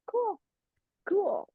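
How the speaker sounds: high, soft little "cool"; mock nonchalance. Feigned nonchalance after being corrected.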